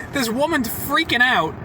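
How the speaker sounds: normal talking voice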